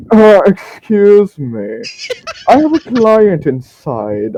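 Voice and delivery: high, loud, drawn-out sing-out; merchant calling out. Acted. Merchant asks orcs outside for privacy, high theatrical call. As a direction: call out the door with showy politeness.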